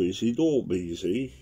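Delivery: ordinary talk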